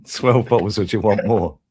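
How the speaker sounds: mate teasing the wife's text back, mocking. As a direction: tease it back, stretched out. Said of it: "repeats the quote".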